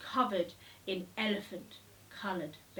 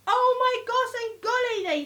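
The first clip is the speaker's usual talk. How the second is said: much higher and louder gasp of shock; startled exclaim; 14 semitones higher, 11 dB louder than usual. A narrator's reading. Jumpy elephants cry out in shock at Elmer's boo. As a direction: jump with fright as you say it.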